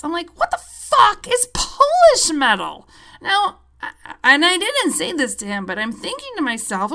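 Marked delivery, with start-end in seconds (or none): 0.4-2.9 much higher, louder disbelief; incredulous. Retold or imitated. Patient on morphine questions the tech, incredulous and high. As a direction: hit the line in stunned disbelief. Baffled by Polish metal.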